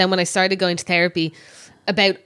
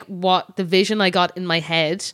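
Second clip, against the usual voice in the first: normal talking voice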